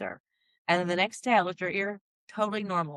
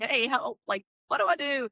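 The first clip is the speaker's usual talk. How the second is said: mock panicked plea; 6 semitones higher than usual. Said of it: "I'm like".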